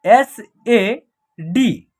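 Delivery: "एस ए डी" letter by letter, slow; spelled out slowly. Shown as a demonstration. Teacher spells the example letter by letter, slowly. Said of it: "ये जो है example है".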